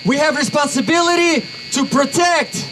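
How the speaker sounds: usual speaking voice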